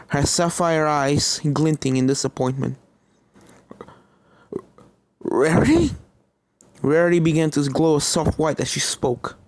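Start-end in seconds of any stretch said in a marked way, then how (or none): 5.2-6.0 high, stunned rising gasp of a name; shocked question. Acted. Starlight recognizing the ghost, high and shocked. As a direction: recognize a dead friend.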